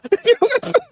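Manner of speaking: short high-pitched giggle; laugh. Real, not acted. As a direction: break into a high little laugh. Laughing at the absurd scene.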